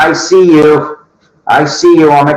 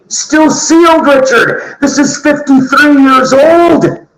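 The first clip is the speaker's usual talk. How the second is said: much higher, slowed for emphasis; excited; 8 semitones higher than usual. Real, not acted. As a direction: marvel at the find, rising excitement. Excited about sealed record.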